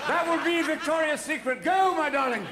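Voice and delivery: a dying prince sending his wife off with grand gestures; hammed-up dying Prince Albert. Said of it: "I'm sure Albert said".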